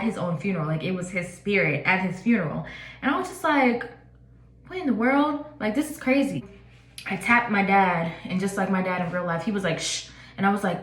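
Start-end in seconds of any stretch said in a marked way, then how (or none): 4.7-6.4 startled disbelief. Retold or imitated. Dream, sees dead grandfather. 9.8-10.1 a soft retold shush; hushing. Dad hushing her at funeral.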